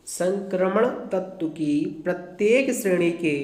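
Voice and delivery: measured dictation with long pauses between phrases; slow dictation. Real, not acted. Teacher dictating an answer for pupils to write down, slowly. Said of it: "मैं थोड़ा धीरे धीरे बोलूँगा".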